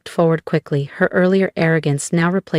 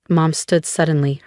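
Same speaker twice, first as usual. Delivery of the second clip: ordinary delivery, nothing marked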